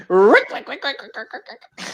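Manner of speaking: rapid repeated creaking noise rising high; imitated car scraping.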